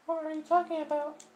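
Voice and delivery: a sweet high voice full of concern; high girlish concerned voice; Sayori's voice. Acted. Voicing Sayori.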